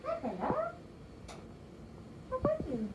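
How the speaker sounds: high soft coo to a cat; pet talk. Real, not acted. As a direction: greet the nervous cat sweetly. Greeting nervous cat softly.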